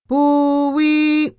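high, loud imitated foghorn; ship's fog signal. A narrator's reading. Ship's signal rings out in the night, imitated foghorn. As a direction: call it out as one long horn blast. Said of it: "The ship's fog signal rang out".

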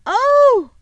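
high, loud, startled "oh"; sudden cry. A narrator's reading. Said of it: "said Susan suddenly".

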